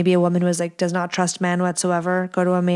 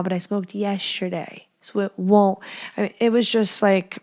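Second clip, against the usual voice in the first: usual speaking voice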